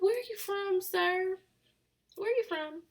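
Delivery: high-pitched mock bafflement; puzzled questioning. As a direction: ask it high, as if baffled by the accent. Acts baffled listener hearing heavy accent.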